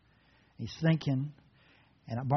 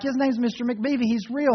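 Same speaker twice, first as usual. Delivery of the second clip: put-on insistent child; 8 semitones higher than usual. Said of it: "And Opie's like".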